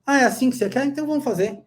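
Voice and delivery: mock obliging practitioner, pitched high; compliant professional. Practitioner giving in to a patient's demand, mock eager and high. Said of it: "tem um profissional que atende a demanda".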